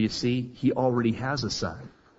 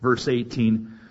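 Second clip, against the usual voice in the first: normal talking voice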